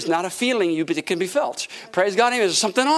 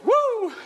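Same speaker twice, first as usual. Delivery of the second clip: high, jubilant "woo"; preacher's ecstatic high holler; exultant whoop. Real, not acted. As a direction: whoop high, overcome with praise. Whooping during a praise break.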